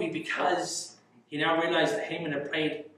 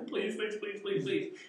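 soft, higher mock begging; pleading; 4 semitones higher, 7 dB quieter than usual. Imitating Haman on his knees before the queen, pleading. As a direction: rattle off little repeated pleas. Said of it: "of Haman's pleading".

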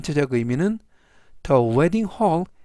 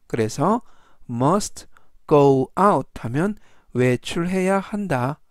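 normal talking voice

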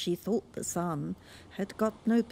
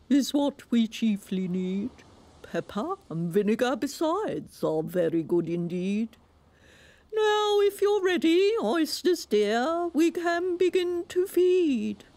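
grand walrus; 8 semitones higher, 4 dB louder than usual. A narrator's reading. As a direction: announce it like a pompous walrus. Walrus speaks.

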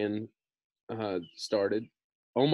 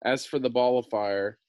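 ordinary delivery

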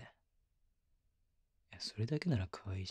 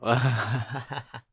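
loud laugh; 2 semitones higher, 11 dB louder than usual. Real, not acted. Laughing at his own weakness.

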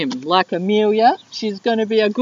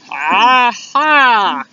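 triumphant cry; 4 dB louder than usual. Acted. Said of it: "cried the Dingo".

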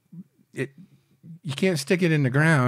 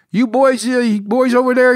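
high, loud mock Dutch accent; 10 semitones higher, 7 dB louder than usual. Dutch captain yelling over the rail to smugglers, loud imitated Dutch accent. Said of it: "in a Dutch accent".

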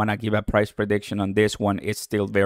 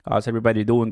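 ordinary talk, nothing marked

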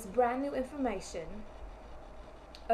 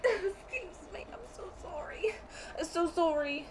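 tearful from laughing; 6 semitones higher than usual. Real, not acted. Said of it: "I'm just crying to".

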